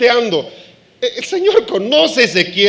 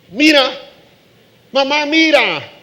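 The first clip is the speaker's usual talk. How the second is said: put-on higher, louder child calling mom; excited little kid telling on a sibling; childish tattle to mom; tattling child; 6 semitones higher, 4 dB louder than usual.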